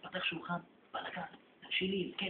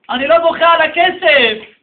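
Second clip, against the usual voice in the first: higher, louder and slow, leaning on לא; emotional outburst; 9 semitones higher, 21 dB louder, slower than usual. Acted. Father tells his son why he weeps, loud and slow. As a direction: burst out that it's not the money.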